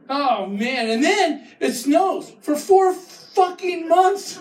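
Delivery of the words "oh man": ordinary talk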